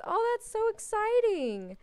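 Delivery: high, loud, slow gushing oh; gushing excitement. Real, not acted. Player gushing over daughter learning plie, high excited squeal. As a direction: squeal it like big news.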